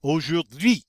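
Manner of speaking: AUJOURD'HUI with AU as O, UI glided; silent H. Shown as a demonstration. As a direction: join up "aujourd'hui" without any H. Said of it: "the H is silent".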